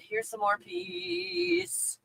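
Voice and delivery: slow, high, mocking sing-song; sarcastic slow peace offer; mock sweet offering. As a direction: dangle it like a sarcastic gift.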